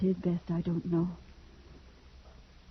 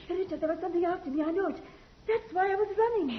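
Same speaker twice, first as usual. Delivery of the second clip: high, fast frightened rush; wife telling husband something chased her through the woods; panicked; 11 semitones higher, faster than usual. Acted. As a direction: blurt it out, still scared. Wife panicked, was being chased.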